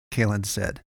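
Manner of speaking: plain reading voice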